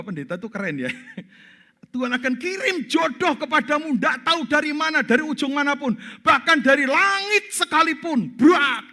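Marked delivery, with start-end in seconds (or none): 1.9-8.4 fervent prayer. Retold or imitated. Preacher re-enacts the prayer. 8.4-8.9 loud sudden bang word; imitated crash. Crash sound effect.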